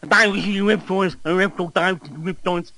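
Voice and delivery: mock believer voice. Mimicking awakened people going on about reptiles, silly high voice.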